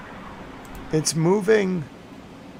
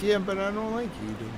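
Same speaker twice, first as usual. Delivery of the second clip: whiny self; 5 semitones higher, 9 dB quieter than usual. Retold or imitated. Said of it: "And I'm like".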